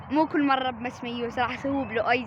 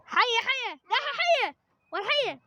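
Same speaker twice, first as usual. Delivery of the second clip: excited teasing call; 10 semitones higher than usual. Pretend. Prank shouting snake again.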